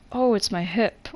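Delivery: usual speaking voice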